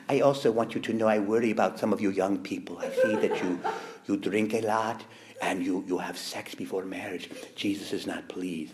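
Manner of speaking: Mother Teresa. Retold or imitated. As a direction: quietly scold the young people like a gentle nun. Voicing Mother Teresa's warning.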